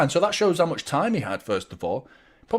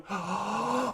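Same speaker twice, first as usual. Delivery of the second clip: sudden shocked gasp; sharp gasp; 8 semitones higher, 9 dB quieter than usual. Real, not acted. Streamer seeing a near own goal, sharp gasp. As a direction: catch your breath at the near miss.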